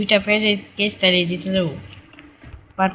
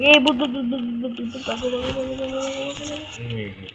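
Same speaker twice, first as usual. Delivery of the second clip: high, rapid stuttered alarm, dropping softer; high-pitched frantic repeating; game panic; 5 semitones higher, 6 dB quieter, faster than usual. Pretend. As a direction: chant it in a panic as the ghost appears. Ghost appears, calls to flee.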